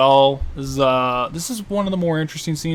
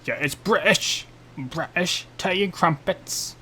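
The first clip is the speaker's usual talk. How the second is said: slow, higher hokey British; terrible British accent; 4 semitones higher, slower than usual. Pretend. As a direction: ham up a bad British accent. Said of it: "I'm just putting on my terrible accent".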